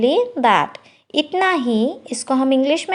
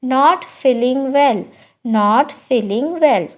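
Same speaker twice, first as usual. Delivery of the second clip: slow, careful repeat; slow teacher repetition; slow demo; much slower than usual. Shown as a demonstration. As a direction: say each word slowly for learners. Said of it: "so कैसे कहेंगे?".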